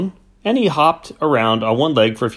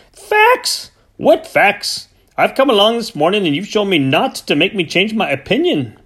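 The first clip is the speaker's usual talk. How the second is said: very high, loud indignant retort; furious farmer's disbelief; outraged farmer; 9 semitones higher, 3 dB louder than usual. Acted. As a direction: throw the word back at him. Cranford disputes the facts.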